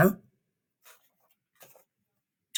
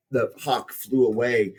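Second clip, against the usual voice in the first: ordinary delivery, nothing marked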